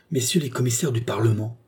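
usual speaking voice

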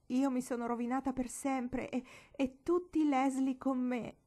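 high, loud wail of ruin; tearful despair. A narrator's reading. Crying girl telling her aunt she is ruined, wailing. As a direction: sob out the disaster. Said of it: "e Marigold gemette".

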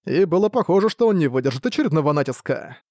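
ordinary delivery

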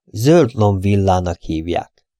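slow, every word spaced out; slowly, clearly. Acted. Poirot naming the villa to the receptionist, slow and spaced. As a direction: lay it out patiently word by word. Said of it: "lassan, tagoltan".

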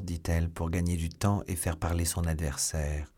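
ordinary delivery, nothing marked

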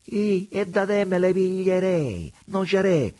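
high, loud mocking vagabond voice; vagabond Preola. A narrator's reading. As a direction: preach it like a sly tramp at God. Preola's voice in the story.

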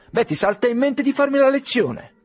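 high, indignant dignitary voice; haughty rebuke. Acted. Dignitary rebuking the clerk, high and indignant. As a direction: put the clerk in his place.

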